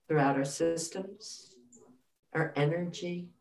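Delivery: soft, hushed meditation voice; guided meditation. Acted. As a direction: guide them gently back. Continues guided meditation softly.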